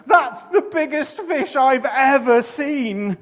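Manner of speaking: high, loud terrified wail; horrified groan. A narrator's reading. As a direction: gape in horror at the fish. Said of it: "Jonah groaned".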